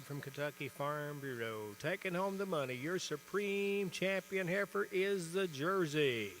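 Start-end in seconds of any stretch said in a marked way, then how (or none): 1.8-6.3 winner announcement. Real, not acted. Announcing the supreme champion winner.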